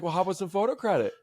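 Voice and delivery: high, fast mock voice of a friend; a hint of a smug buddy asking for credit; imitating Guy. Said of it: "And Guy's like".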